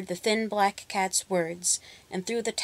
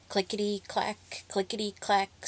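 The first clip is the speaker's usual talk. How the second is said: soft loom sound; 5 dB quieter than usual. A narrator's reading. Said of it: "sang the loom".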